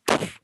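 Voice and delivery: short noisy puff of air; explosive "poof" spitting noise; imitated water spit. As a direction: mimic spraying a mouthful of water. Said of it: "and just spat water everywhere".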